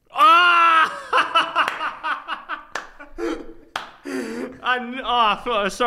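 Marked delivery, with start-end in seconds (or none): 0.1-1.0 sudden high shriek that breaks into laughter; a loud, high yelp of disbelieving laughter. Real, not acted. Reacts to wrong answer. 1.0-4.6 long, high, helpless fit of laughter; long laughing fit. Real, not acted. After the outrageous answer.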